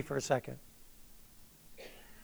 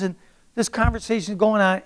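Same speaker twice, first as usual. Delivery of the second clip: usual speaking voice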